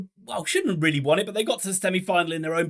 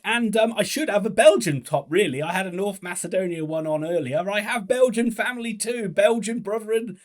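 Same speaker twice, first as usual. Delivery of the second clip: ordinary talk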